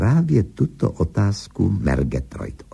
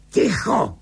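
roared command; 13 semitones higher than usual. A narrator's reading. Husband silences his wife for the first time, high yell. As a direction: cry out for silence, pitch leaping. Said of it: "Zařval".

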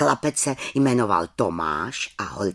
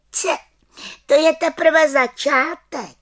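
high, louder, slow declaration; witch boasting; 6 dB louder than usual. Acted. Little witch boasts to children, high voice. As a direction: promise more in a high witch voice. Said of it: "prohlásila malá čarodějnice".

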